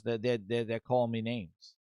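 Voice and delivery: low, soft, stammering victim act; mock tearful complaint. Booty cries to HR about name-calling, mock tearful complaint. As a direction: play wounded in a hushed, low voice. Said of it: "He goes, cries to them".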